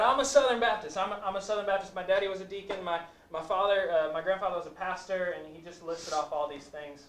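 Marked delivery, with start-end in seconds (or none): 0.0-5.4 higher and louder, listing credentials; proud Southern Baptist. Retold or imitated. Student boasting of his church family, high and proud. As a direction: puff up and rattle off his pedigree. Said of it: "And he said".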